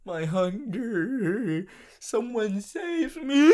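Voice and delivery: slow, high, crying wail; overdone tearful cry for help; mock sobbing plea. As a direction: beg for rescue in a hammed-up sob.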